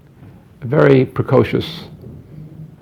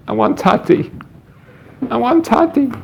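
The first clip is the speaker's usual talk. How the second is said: lightly voiced whining child, rising high on the repeat; crying son imitated; 10 semitones higher than usual. Son crying to mother at bedtime, imitated whine. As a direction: mimic a small boy pleading at bedtime. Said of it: "my son started to cry".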